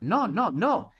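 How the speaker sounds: ordinary talk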